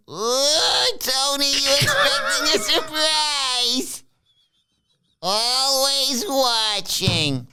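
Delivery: high, very slow eerie put-on voice; drawn-out creepy teasing voice; Riz voice. Riz teasing Tony about a surprise, high and very slow. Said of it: "Riz says".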